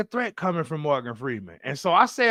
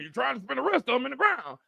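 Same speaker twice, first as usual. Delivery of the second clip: Morgan Freeman; 6 semitones higher, faster than usual. Retold or imitated. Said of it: "And Morgan Freeman".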